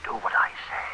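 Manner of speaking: hushed, pressing whisper; whispered order; urgent whisper. Acted. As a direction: push her on without being overheard. Hushed order before the victim enters.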